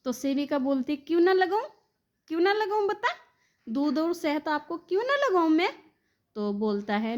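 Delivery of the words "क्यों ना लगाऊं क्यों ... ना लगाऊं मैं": high-pitched, mock-indignant retort; indignant challenge. Caretaker challenges child refusing honey, mock-indignant. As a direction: throw the question back in a much higher voice.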